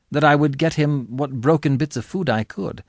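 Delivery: plain reading voice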